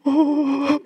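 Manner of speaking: high, soft caught-out "Oh"; startled teen; small stunned "Oh". Retold or imitated. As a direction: voice the boy, suddenly unsure. Boy caught out.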